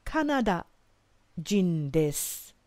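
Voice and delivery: drawn-out careful Japanese phrase; slow model phrase. Shown as a demonstration. Teacher modelling Kanada jin desu slowly for learners. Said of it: "We could simply say".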